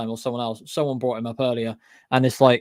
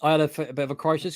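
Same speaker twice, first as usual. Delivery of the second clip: ordinary talk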